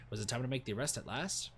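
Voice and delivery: ordinary delivery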